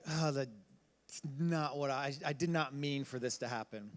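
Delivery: low, very soft inner voice; hushed regret. Retold or imitated. Voicing someone who made a bigger mistake, hushed regret. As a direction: act out remorse, halting and quiet.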